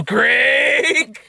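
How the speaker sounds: high, drawn-out yell of a name; hammed-up high holler; mock yell. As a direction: cry it out high, as if blaming someone. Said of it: "you just yell".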